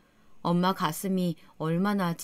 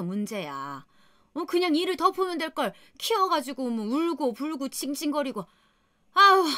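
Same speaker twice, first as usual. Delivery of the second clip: higher, sneering, biting scold; vicious mother-in-law; 5 semitones higher than usual. Retold or imitated. Mother-in-law lashing back at her daughter-in-law, higher sneering scold. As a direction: tear into her with contempt. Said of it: "더 매섭고 독하게 물어뜯더라고요".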